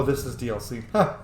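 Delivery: ordinary delivery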